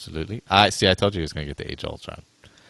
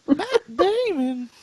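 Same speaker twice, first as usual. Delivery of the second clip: very high squeaky voice; much slower than usual. Pretend. Repeating name in silly voices.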